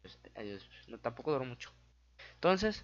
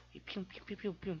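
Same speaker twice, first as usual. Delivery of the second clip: pew pew shots; 4 semitones higher, 6 dB quieter than usual. Pretend. Mimicking shots in a fight.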